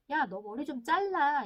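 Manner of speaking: acted insistent nagging; pushy parent. Parent demands child get a haircut, pushy. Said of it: "이 말이 강요하는 말이기".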